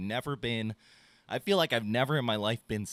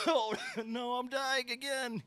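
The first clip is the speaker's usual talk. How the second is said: high, slow, hammed-up moan with a laugh in it; mock dying whine; 13 semitones higher, 3 dB quieter, slower than usual. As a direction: groan it out like you're dying, laughing at yourself. Acting out his fever morning.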